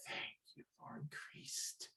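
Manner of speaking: hushed whispered thanks to God; grateful whisper. Acted. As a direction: breathe a secret thank-you prayer. Bridget's relieved private prayer.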